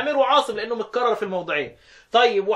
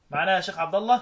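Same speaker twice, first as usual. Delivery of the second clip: ordinary talk, nothing marked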